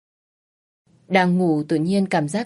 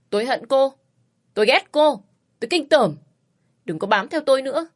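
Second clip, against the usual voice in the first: angry rejection; 6 semitones higher than usual. A narrator's reading. Quân shouting hatred.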